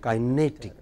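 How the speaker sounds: "Kinetic" with a strong NE; stress on NE. Shown as a demonstration. Teacher explaining the I C rule, stress on NE. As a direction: stress the middle syllable of "Kinetic". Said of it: "takes immediately before".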